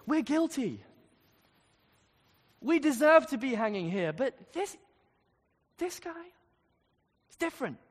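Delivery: a hint of a desperate criminal on the cross; lightly voiced repentant criminal. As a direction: voice the dying thief pleading with conviction. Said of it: "He says to the other criminal".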